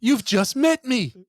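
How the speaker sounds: high, slow, drawn-out quote; acted, affronted comeback; indignant film retort. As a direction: stretch out each word in mock offence.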